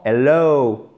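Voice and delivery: slow teacher's demo of hel-LOOW; drawn-out diphthong ending. Shown as a demonstration. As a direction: drag out the end of hello as a double sound. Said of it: "vous faites traîner sur la fin".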